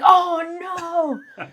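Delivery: high, loud "Oh, no" of horror; disgusted dismay. Retold or imitated. Partner realizes he barehanded it, high loud horrified cry. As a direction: recoil as the realization hits. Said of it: "and he's like".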